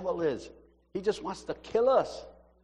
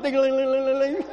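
high, rapid sing-song jingle; ringing bell imitation; 10 semitones higher, 4 dB louder than usual. Former altar boy mimicking the bells at communion, ringing bell imitation. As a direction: trill it like little altar bells. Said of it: "I love to ring those bells".

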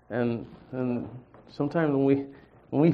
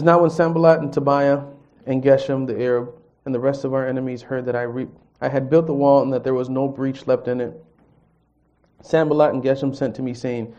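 louder, measured reading of the verses; scripture reading; 5 dB louder than usual. Acted. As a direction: read the passage to the congregation. Reads the scripture aloud.

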